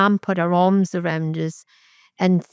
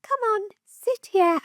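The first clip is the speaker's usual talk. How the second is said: much higher, softer sweet coaxing; fake-sweet actress voice; 3 dB quieter than usual. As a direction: play the sweet innocent mother. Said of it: "the forever actress".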